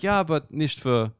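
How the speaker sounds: put-on slow German-accented refusal; Dutch-sounding German accent, drawn out; mock German accent. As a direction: play a comic German turning someone down. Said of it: "the Dutch accent as a German guy".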